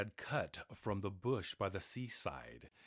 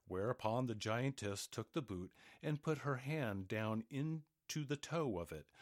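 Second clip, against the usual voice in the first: plain reading voice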